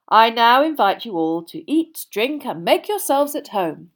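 loud, lifted ceremonial voice; stately, projected speech to a hall; Dumbledore. A narrator's reading. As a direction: welcome the guests like a grand old headmaster. Dumbledore opens the feast.

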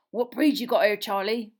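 big gruff gamekeeper, in awe; Hagrid voice. A narrator's reading. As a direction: ask with hushed reverence.

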